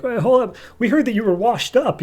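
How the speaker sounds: high-pitched, flustered backpedalling; mock alarmed protest. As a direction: plead for a halt in a high voice. Abysmus panicking as he is attacked.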